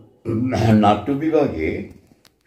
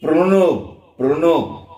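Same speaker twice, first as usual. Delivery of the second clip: calling out; 6 semitones higher, much slower than usual. Shown as a demonstration. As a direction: shout Pranab across the room. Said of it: "এরকমভাবে ডাকি". (নাম ধরে জোরে ডাকা)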